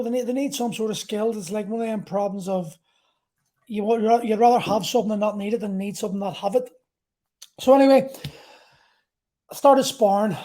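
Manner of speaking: ordinary delivery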